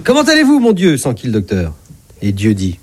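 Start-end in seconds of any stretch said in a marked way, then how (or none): 0.0-1.0 high-pitched greeting to a patient; doctor opening a consultation, pitched up high; doctor's greeting. Acted. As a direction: greet the patient in a raised, lilting voice. Doctor cheerily greets his patient, God.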